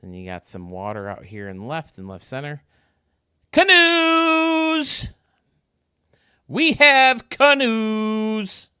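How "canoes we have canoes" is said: loud, high burst of delight at spotting canoes; excited shout. Real, not acted. Gamer shouting to viewers about canoes, thrilled.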